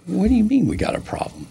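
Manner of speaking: high-pitched disbelieving question; retold indignant disbelief; incredulous challenge. Acted challenge to the official.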